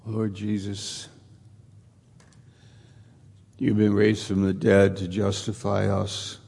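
prayer. Acted. Pastor leading the congregation in closing prayer, hushed and reverent. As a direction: pray aloud, gently and unhurried.

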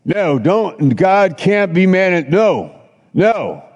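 high, frantic pleading no's; a hammed-up panicky devil. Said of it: "and the devil would say".

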